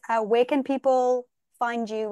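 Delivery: ordinary talk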